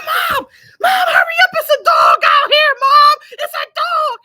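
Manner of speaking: high, loud panicked calling for mom; frantic yell. Retold or imitated. Locked out, yelling for her mom to open the door, panicked. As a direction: scream for her to hurry up.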